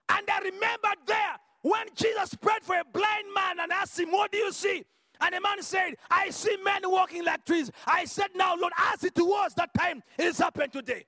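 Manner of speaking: loud, high preaching cry; full-force preaching. Acted. Preacher to the congregation, retelling the miracle in a loud preaching cry. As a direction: proclaim it to the whole church.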